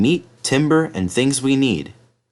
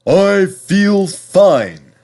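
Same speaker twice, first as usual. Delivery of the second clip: Henry the engine; 9 semitones higher, 6 dB louder, much slower than usual. Acted. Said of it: "whistled Henry".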